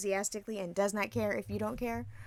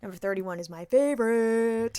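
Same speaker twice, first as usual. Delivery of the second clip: slow, drawn-out, louder delight; gleeful announcement; 3 semitones higher, 5 dB louder than usual. Real, not acted. Host announcing a favorite list item, gleeful. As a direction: savor it loudly, stretching the last word.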